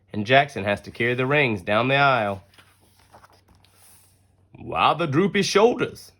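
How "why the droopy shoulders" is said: lightly voiced stepdad, higher and teasing; a friendly dad asking gently, a touch higher; Bill's voice. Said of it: "Bill asks".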